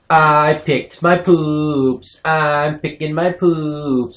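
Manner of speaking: put-on very slow, eerie child voice; creepy toddler. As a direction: intone it slowly like a creepy little kid. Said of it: "I hear her say behind me".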